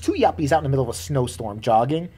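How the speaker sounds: ordinary talk, nothing marked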